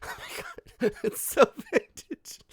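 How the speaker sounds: squeaky high line broken by real laughter; can't get the words out for laughing; laughing through it. Real, not acted. Host cracking up mid-impression of Meghan's wine, laughing.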